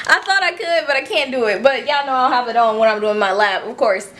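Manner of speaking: normal talking voice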